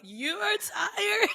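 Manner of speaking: slow, high disbelieving shout; incredulous. Retold or imitated. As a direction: stretch it out in disbelief. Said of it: "I'm like".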